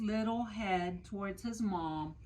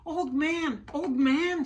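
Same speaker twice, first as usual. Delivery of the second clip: high, loud call across the yard; shouting; 7 semitones higher, 7 dB louder than usual. Acted. Little Chang shouts for the old man with the ladder, loud and high. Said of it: "shouted Chang".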